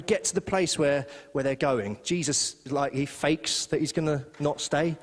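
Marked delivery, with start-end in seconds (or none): none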